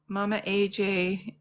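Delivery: ordinary delivery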